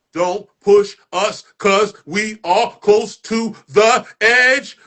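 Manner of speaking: building, rhythmic spoken-word delivery; spoken word performance. Acted. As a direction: perform it like a poem slam, rising toward the shouts.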